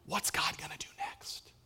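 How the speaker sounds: hushed, expectant whisper; awed whisper. Acted. Believer waking up to what God might do, whispered. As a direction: whisper it in eager wonder. Acting out waking with holy anticipation.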